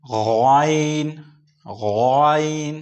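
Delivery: गाइन slow with a guttural gh from the throat; teacher drilling ghain; throaty ghain. Shown as a demonstration. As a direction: gargle the gh of गाइन.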